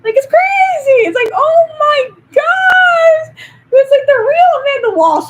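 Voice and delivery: high, loud, drawn-out excited yelling; excited shout. Real, not acted. Fan shouting about the new Joker cast, excited. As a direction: scream out the hype, stretching words.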